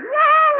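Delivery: long high, loud whoop of joy; joyful cheer. Acted. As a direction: whoop as the rocket races. Joyriders cheer their speeding rocket.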